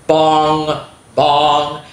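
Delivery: loud ringing bong; clock bong. A narrator's reading. Narrator sounds the clock striking two. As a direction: ring it like a clock. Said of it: "clock strikes two".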